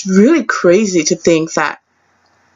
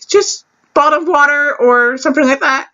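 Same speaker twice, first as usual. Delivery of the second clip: higher, offhand quoted voice; boyfriend's reply; 6 semitones higher than usual. Retold or imitated. Boyfriend ordering for them, higher and offhand. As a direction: toss it off as the boyfriend.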